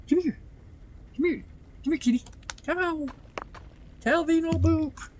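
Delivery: high coaxing kitty call; calling the cat. Real, not acted. Calling the cat to come up, high coaxing voice. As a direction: coax the cat over, pitched high.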